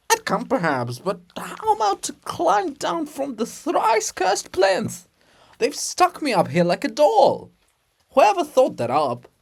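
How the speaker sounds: lightly voiced, high fretful statue; grumbling monument. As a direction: grumble about being stuck up here. Monument complains from its plinth.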